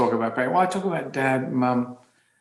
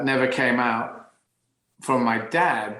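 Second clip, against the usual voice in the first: ordinary talk, nothing marked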